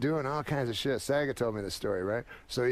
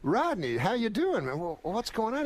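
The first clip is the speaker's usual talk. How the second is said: much higher, surprised greeting; imitating shocked Saget; 8 semitones higher than usual. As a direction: greet him as if stunned he is out.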